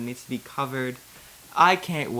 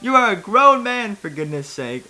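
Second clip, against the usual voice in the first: scolding; 7 semitones higher, 10 dB louder than usual. Acted. Scolding the crying Jedi.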